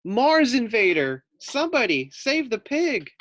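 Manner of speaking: high, loud frantic squealing voice; panicked pig. Acted. Frightened Fluffy shrieks for rescue from the space monster. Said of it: "thought Fluffy".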